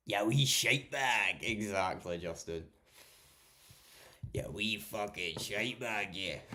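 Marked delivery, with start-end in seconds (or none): 0.1-1.4 loud, drawn-out Scottish jeer; broad Scottish accent. Retold or imitated. Quoting a Scottish insult back to Justin, loud broad Scottish accent. 4.3-6.4 slow, drawn-out Scottish jeer; broad Scottish accent. Retold or imitated. Repeating a Scottish insult for Justin, slow broad accent.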